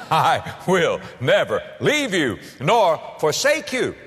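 ringing preached cry of the promise. Acted. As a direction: call it out over the congregation. Preacher crying out the verse.

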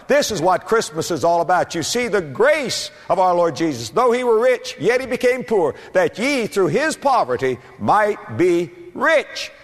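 high-pitched, climactic sermon delivery; soaring pulpit voice; impassioned preaching. Real, not acted. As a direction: proclaim it to the whole church, slowing at the end. Preaches at full force.

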